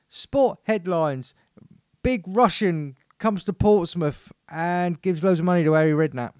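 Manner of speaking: loud, punchy headline read; mock sports headlines. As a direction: announce the headlines like a spoof newsreader. Pretend headlines after the jingle.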